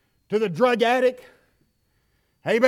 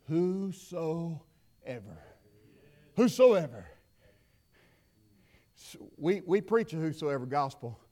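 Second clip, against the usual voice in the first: much softer, lower preaching; quiet preaching; 7 semitones lower, 8 dB quieter than usual. Acted. Preacher quietly rebuking the congregation, hushed. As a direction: drop right down to a near hush.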